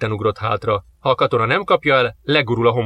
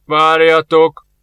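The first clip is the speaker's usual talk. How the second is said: louder, slow command; 4 semitones higher, 7 dB louder, much slower than usual. Acted. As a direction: order them to wait. Hidden voice commands.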